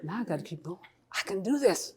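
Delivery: hushed pep talk to oneself; private self-talk. Acted. Cathy urging herself on after enlisting, hushed self-talk. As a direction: drop right down as if nobody should hear.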